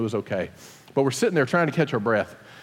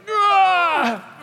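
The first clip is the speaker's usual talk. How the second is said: high, sudden cry of effort; strained cry; 13 semitones higher than usual. Retold or imitated. Father straining up the hill on his bike, high cry of effort. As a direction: let out a pushing-uphill yelp. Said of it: "I was like".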